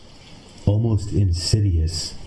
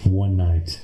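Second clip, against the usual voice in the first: usual speaking voice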